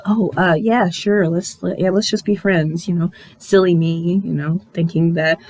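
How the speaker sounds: imitating hurt, low and halting; hurt, covering up. Hurt woman agreeing to just be friends, subdued. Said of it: "she's, like, all hurt and shit".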